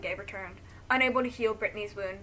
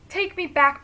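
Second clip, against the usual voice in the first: sobbing; 8 semitones higher, 10 dB louder, slower than usual. Acted. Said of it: "Brittany sobs".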